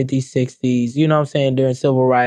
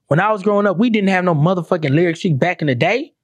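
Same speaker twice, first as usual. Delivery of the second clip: ordinary delivery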